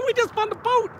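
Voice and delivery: high-pitched, laughing disbelief; giddy laugh all through the words. Real, not acted. Laughing over finding a boat.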